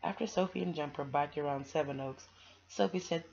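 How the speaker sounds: put-on bright, lively reading to children; storybook read-aloud. A narrator's reading. Reader sharing a picture book with kids, lively storybook voice. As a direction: read it like bedtime story time.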